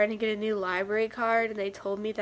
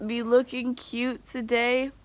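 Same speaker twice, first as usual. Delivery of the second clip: ordinary talk, nothing marked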